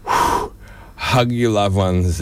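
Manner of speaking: slow, emotional plea; choked-up, unhurried appeal. Real, not acted. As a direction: say it slowly, holding back tears. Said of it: "A bit emotional".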